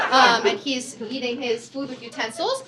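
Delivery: ordinary talk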